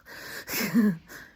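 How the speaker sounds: short laugh through tears; teary laugh. Real, not acted. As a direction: laugh it off, still emotional. Laughs after getting emotional.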